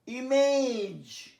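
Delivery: ইমেজ drawn out very slowly, each sound clear; careful pronunciation. Shown as a demonstration. Teacher modelling a word for students, very slow. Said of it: "special উচ্চারণ বলে আমি star দিয়েছি".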